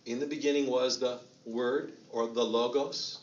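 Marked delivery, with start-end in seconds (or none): 2.4-3.2 "logos" as LOH-gohss, long O twice; English pronunciation. Shown as a demonstration. Preacher reading John to congregation, "logos" as English LOH-gohss. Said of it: "I'll just be English here".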